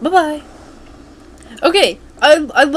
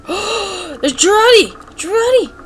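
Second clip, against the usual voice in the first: high, drawn-out delighted cry; excited calling out; 10 semitones higher, 3 dB louder, slower than usual. Real, not acted. Player spots Girardi in the game, excited cry. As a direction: call the name high and excitedly.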